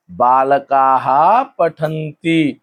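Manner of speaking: बालकाः पठन्ति very slowly, with "kaa-haa" stretched; long-vowel plural. Shown as a demonstration. Teacher modelling the plural sentence बालकाः पठन्ति, long drawn-out vowels. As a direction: draw out बालकाः, then sound each syllable of पठन्ति. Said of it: "का पे डंडा आ गया और हा".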